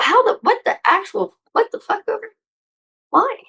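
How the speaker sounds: high, disbelieving what the fuck; shocked squeal of disbelief; incredulous outburst. Real, not acted. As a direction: pitch shoots way up in disbelief. Baffled outburst at no-shows.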